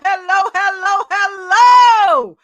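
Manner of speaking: hellos climbing to a shout; building greeting. Acted.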